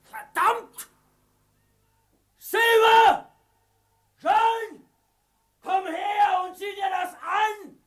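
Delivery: frantic shout. Acted. Pirate shouts, finds empty pit.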